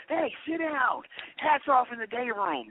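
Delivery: high-pitched mock officer's yell; imitated yell. Corrections officer picking at inmates, mock yelling. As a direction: holler orders in a high, mocking voice. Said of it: "they wanna yell at us".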